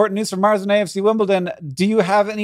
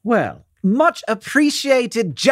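slow, mock-British thanks; put-on British accent; 3 semitones higher, slower than usual. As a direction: slip into a hammed-up British accent. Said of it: "it happened again".

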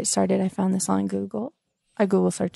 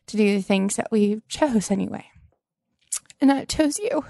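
poem reading; 2 semitones higher than usual. Acted. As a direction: swell up on the name like a vow. Reads a love poem to husband.

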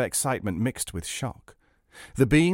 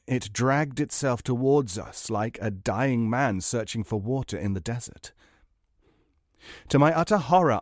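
plain reading voice

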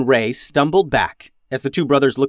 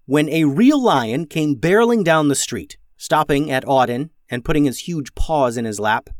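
plain reading voice

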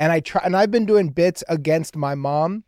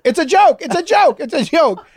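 high, louder rushed "it's a joke"; frantic reassurance; 9 semitones higher, 4 dB louder than usual. Retold or imitated. Boy calming his panicking mom, high frantic voice. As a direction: hurry to calm her down, raised and high. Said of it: "And then I'd go like".

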